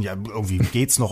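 usual speaking voice

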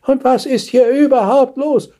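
put-on high, brittle old woman; cracked old voice. A narrator's reading. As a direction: ask like a frail ancient lady. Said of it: "fragte sie mit brüchiger Stimme". (brüchige, hohe Greisinnenstimme)

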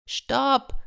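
high-pitched cry to halt; boy calling out. A narrator's reading. Boy halts the armed villagers, calling out. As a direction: call a halt without full volume. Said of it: "rief der Junge".

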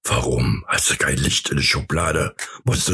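deep teasing voice; deep mock voice. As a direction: drop way down to tease. Mocking reply in deep voice.

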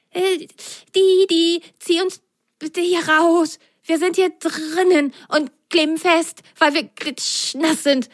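high small pleading voice; pleading sock. Acted. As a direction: ask the dog nicely for help. (bittende Sockenstimme)

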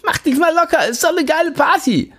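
put-on very high, slurred party drunk; a wasted guy slurring with glee. A narrator's reading. Jimmy to narrator, slurring drunk and high-pitched. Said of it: "lallt Jimmy".

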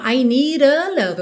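"need a" with a flapped "nee-ra"; tapped D. Shown as a demonstration. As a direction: link "need a" with a quick tap. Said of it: "estaria ligando a uma vogal".

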